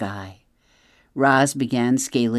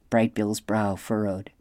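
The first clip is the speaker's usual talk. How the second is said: ordinary delivery, nothing marked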